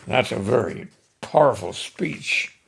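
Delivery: ordinary delivery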